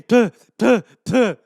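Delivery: loud mock spitting; spitting sounds. Comedian mimes spitting out the yogurt, loud spitting. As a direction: spit it out, puh puh. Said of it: "I'm just spitting".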